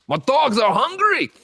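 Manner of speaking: high, slow hammed-up villain cry; dog-owning villain. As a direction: draw it out in a theatrical high voice. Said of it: "the other guy who has the Rottweilers".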